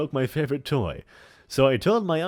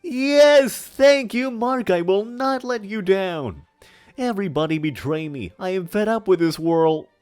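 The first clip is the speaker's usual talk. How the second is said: much higher, louder theatrical joy; hammy stage actor; 11 semitones higher, 9 dB louder, slower than usual. Acted. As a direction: gush with hammy gratitude. Overjoyed in character.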